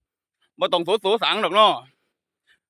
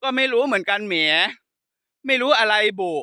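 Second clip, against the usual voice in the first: higher-pitched Khon Kaen Thai-Lao mimicry; Khon Kaen accent; 4 semitones higher than usual. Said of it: "มันจะพูดภาษาอย่างนี้เลย". (เลียนสำเนียงขอนแก่นไทยปนลาว เสียงสูง)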